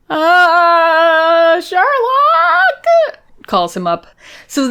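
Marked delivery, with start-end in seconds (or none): 0.1-3.2 calling out. Retold or imitated. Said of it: "is like".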